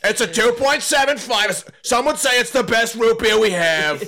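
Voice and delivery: high, slow drawn-out Trump boast; hammed-up Trump bragging about a score; Donald Trump impression. Rating root beer for podcast friends, mock Trump voice. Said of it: "That's my best Donald Trump impression".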